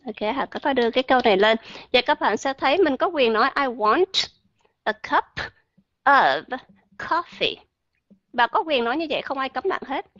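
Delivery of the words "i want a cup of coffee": I want a cup of coffee, jedes Wort abgehackt mit Pausen; word by word. Shown as a demonstration. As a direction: jeden Laut einzeln und mühsam aussprechen. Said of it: "tự mình sẽ mệt".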